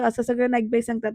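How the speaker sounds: ordinary delivery, nothing marked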